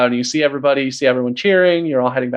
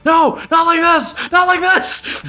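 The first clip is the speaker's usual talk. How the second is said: high, desperate cry from inside the spider; panicked screaming. Acted. Zenko trapped inside the spider, screaming in panic. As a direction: scream it in terror. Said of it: "you just hear Zenko screaming from inside".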